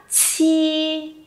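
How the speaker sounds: "Chi" opened with a big puff of air, then held high and level; strongly aspirated initial. Shown as a demonstration. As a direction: push the breath out hard on "Chi" and sustain. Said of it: "Bật ra thật to nhá".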